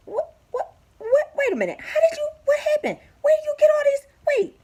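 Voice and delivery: high, loud, stammering amazement; shocked disbelief. Retold or imitated. Worker returning to find the job done, stunned and loud. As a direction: gasp out his shock as if he can't believe it.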